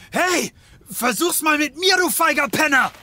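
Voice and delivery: furious yelling after a fleeing thug; angry shout. Acted. Detective shouting after the attacker, furious. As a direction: bellow at him to stop and fight.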